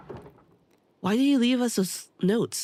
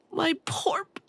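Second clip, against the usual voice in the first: broken whisper; 9 semitones higher, slower than usual. Acted. Grieving NPC breaks off.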